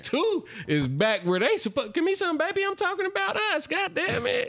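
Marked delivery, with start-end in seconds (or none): 1.9-4.5 high, excited hollering; squealing victory cheer; hyped celebration. Real, not acted.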